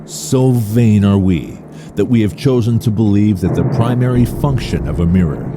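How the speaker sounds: ordinary delivery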